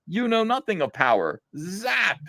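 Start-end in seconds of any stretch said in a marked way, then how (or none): none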